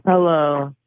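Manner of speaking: usual speaking voice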